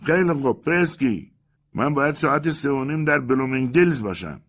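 calling across worlds to be pulled back; shouting. A narrator's reading. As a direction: shout out from inside the novel. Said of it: "کوگلماس فریاد زد".

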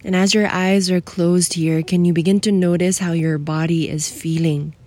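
soothing guide for someone feeling alone; guided meditation. Acted. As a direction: ease the listener into stillness.

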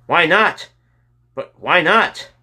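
high, loud exasperated question; angry repeated demand. A narrator's reading. Fireman calling to a man in a truck, angrily. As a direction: call it out angrily, punching each question. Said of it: "angrily saying again and again".

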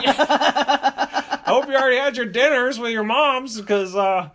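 high, loud mock dad excuse-making; fussy high-voiced dad dropping hints; passive-aggressive. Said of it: "It's so passive-aggressive".

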